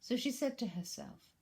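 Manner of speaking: usual speaking voice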